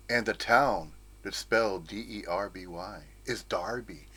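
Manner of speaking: normal talking voice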